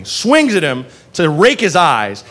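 ordinary talk, nothing marked

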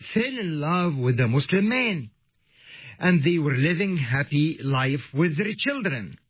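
ordinary talk

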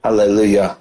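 ordinary talk, nothing marked

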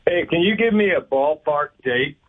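ordinary delivery

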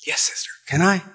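hushed whisper, hopeful; whispered plea. Acted. Girl whispering her hope to the sister. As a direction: breathe it out in a whisper.

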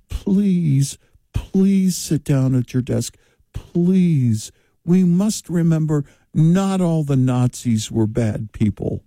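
pleading third-grade teacher voice; drawn-out, imploring mock schoolmarm; Elizabeth Warren. Schoolteacher begging children to behave and sit down, pleading mock voice. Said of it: "sounds like she's a third grade school teacher".

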